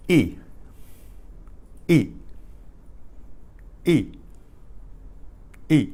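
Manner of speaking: E said as a short, clean "ee"; letter sound demo. Shown as a demonstration. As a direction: model the vowel E by itself, repeated with pauses. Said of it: "pronounced the same way".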